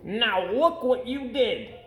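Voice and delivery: high, slow, scolding fish voice. A narrator's reading. Fish scolding the cat for the mess, high and slow. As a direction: drag out the blame. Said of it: "said the fish to the cat".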